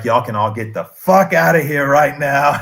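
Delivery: loud, emphatic retold outburst; imitated loud dismissal; exasperated order. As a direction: raise your voice as the fed-up leader ordering everyone out. Cult leader's order escalates.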